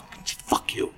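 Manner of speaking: barely voiced, hushed curse; seething mock curse. Host recalling his hatred of a TV character, seething quietly. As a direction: curse under your breath.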